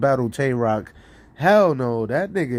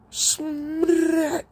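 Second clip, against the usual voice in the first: much higher, stretched ad lib tag; ad lib shout. Retold or imitated. Rapper repeating his friend's ad lib, high and stretched. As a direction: sing out the ad lib like a hype man. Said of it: "putting my ad lib on you".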